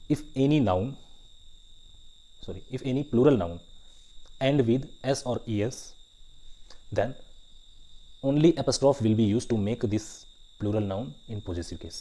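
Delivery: slow, spaced-out dictation with firm emphasis. Real, not acted. Teacher dictating a grammar rule for students to write down, slow dictation. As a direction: dictate phrase by phrase, leaving time to write.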